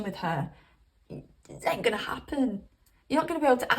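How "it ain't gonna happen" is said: whispered aside. Real, not acted. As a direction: drop to a whisper to tease.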